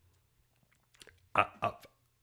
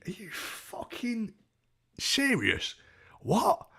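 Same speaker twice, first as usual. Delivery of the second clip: incredulous; 12 semitones higher, much slower than usual. Retold or imitated. Thinking in bed as she comes up, incredulous. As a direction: stretch it out in high-pitched disbelief.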